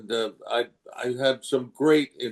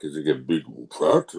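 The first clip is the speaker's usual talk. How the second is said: low, heavy big-bodied voice; big fat character; 7 semitones lower than usual. Shown as a demonstration. Actor demonstrating character physicality, big fat voice. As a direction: sink the pitch like a lumbering heavyweight. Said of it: "look at the physicality of the character".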